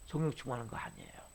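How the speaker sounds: a sudden whispered warning to the flock; dramatic whisper. Real, not acted. Preacher whispers a warning to the congregation. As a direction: whisper it, breath only.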